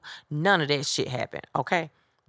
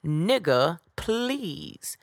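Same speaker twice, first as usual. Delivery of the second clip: slow, emphatic, dismissive impression; Miss Sophia; 3 semitones higher, 4 dB louder, much slower than usual. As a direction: hit each word hard, swooping high on "please". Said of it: "in my best Miss Sophia voice ever".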